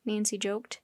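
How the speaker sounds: plain reading voice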